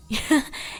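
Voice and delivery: short light giggle; a soft amused giggle. A narrator's reading. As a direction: Luna giggles at her sister's complaint, lightly. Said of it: "Luna giggled".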